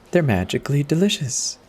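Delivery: lightly mimicking the TV ad announcer; commercial jingle voice. Narrator recalling the cereal ad, lightly imitating the commercial. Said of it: "reminiscing on those old commercials".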